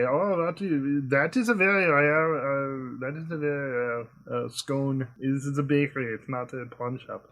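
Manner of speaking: put-on a German baker correcting a customer, in accent; German shopkeeper accent. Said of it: "German shopkeepers who talk like this".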